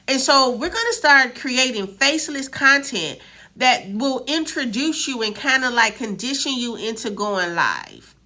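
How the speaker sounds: ordinary talk, nothing marked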